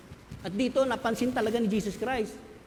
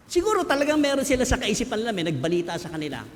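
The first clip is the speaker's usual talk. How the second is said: ordinary talk, nothing marked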